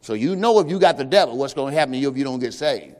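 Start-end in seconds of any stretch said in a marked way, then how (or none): none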